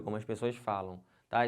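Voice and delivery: usual speaking voice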